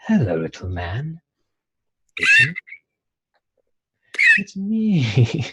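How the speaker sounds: soothing, eerie whisper-sweet croon; soothing creepy angel. Acted. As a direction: lure the little guy in gently. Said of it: "he's talking very soothingly".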